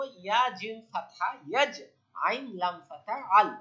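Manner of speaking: ordinary talk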